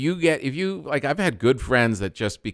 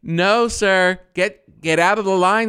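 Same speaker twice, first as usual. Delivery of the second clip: raised, firm imitation of an official; stern gate agent; 9 semitones higher, 4 dB louder, slower than usual. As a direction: send a cheater out of line. Gate agent rebuking line-cutter, acted.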